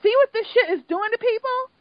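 high-pitched, indignant swearing; retold outburst, rising in outrage; worked-up outburst. Viewer reliving their reaction to the show, worked-up outburst.

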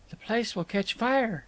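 high, querulous objection. A narrator's reading. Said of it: "she began querulously".

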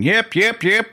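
imitating curlers yelling yep; shouting yep. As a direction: call it down the ice like a sweeper. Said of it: "everybody's shouting".

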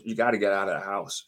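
low, ominous quoted warning; grave retold warning. Retelling woman's grave warning.

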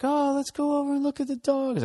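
lightly mocking her eager suggestion; imitating girlfriend. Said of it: "So she's just like".

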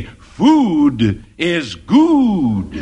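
drawn-out, very slow sung tagline. Acted. Ad announcer drawing out the jingle's last line slowly. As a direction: stretch every word like an ad slogan.

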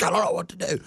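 high, frantic imitation of the wife; panicked wife imitation. Husband imitating his wife watching the yard burn, high and panicky. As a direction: mimic her panic, pitched well up. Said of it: "she's like".

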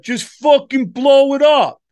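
loud, high, wild warmonger voice; trigger-happy guy. Acted. Reckless guy yelling to blow a country up, loud and high. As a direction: yell it like a reckless guy eager to bomb. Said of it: "you don't want a guy like".

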